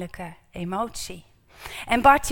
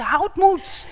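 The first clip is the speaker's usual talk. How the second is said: high, louder call of courage; encouraging call; 8 semitones higher, 8 dB louder than usual. Acted. The crowd suddenly encourages Bartimaeus, raised higher call. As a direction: call out to lift him up. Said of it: "zegt de menigte".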